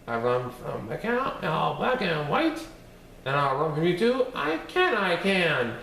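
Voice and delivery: high, sing-song taunting cookie voice; bouncy little gingerbread man bragging; cheeky gingerbread man. Acted. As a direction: tease them from far out of reach.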